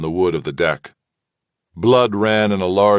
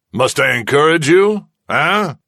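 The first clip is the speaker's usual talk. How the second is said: higher, louder mocking threat; threatening; 8 semitones higher, 4 dB louder than usual. A narrator's reading. Kazala threatens the silent prisoners, raised taunting voice. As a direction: push them with a taunting jab.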